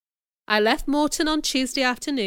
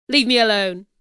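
rude; 5 dB louder than usual. A narrator's reading. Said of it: "answered Mister Rochester rudely".

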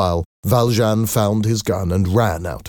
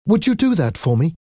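high-pitched request; pleading; 10 semitones higher than usual. A narrator's reading. Young woman asking Eponine a favour, pleading.

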